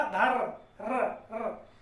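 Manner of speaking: धर with the र drawn out, then र र alone; held r sound. Shown as a demonstration. Teacher stretches the र of धर्म for students, drawn out. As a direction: hold the र of धर्म and let it stand alone. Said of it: "थोड़ी देर तक उच्चारण करें".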